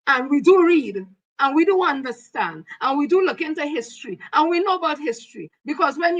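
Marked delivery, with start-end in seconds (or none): 0.1-4.2 each do hit hard and falling; emphatic do. Real, not acted. To the colonel, pressing on each do, insistent. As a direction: stress do in each do phrase, pressing the point. Insists they understand history.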